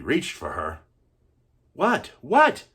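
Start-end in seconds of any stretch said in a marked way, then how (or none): 1.7-2.7 sharply higher, rising louder; indignant what. Acted. Boy objects in disbelief to his grandfather's story. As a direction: protest in disbelief.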